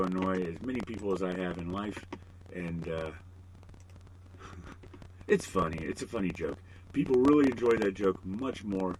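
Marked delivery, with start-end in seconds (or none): none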